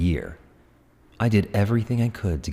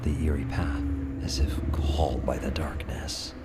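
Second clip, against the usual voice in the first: hushed, breathy whisper; eerie whisper; 3 semitones lower, 7 dB quieter than usual. Acted. Narrating being drawn down the eerie path, whispered. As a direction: breathe it like the dark is listening.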